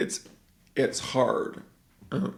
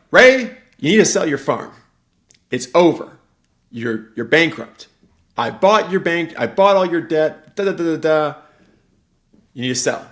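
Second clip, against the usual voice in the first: louder, higher, bossy demand; pushy brother; 6 semitones higher, 11 dB louder than usual. Retold or imitated. Brother pressing Ray to sell, louder and higher. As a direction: bark it at him like a creditor. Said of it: "And he's like".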